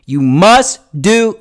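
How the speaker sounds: loud, slow, high emphasis; driving it home. Shown as a demonstration. Coach demonstrating how to hammer a point, loud. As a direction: punch each word out forcefully. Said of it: "drive the point home".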